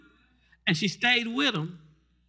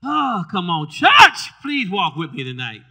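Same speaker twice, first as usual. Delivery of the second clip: calling out; 14 dB louder, slower than usual. Real, not acted. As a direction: call out to the congregation. Preacher calls out to church.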